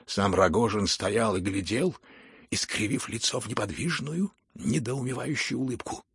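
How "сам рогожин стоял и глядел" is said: ordinary delivery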